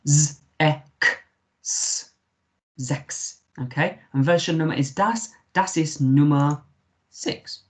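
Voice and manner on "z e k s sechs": "Z, E, K, S" separately, then "sechs"; "sechs" piece by piece, said as "zeks"; sounded out. Shown as a demonstration. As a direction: build "sechs" from buzzed z, eh, k, s. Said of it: "C H in German becomes a K".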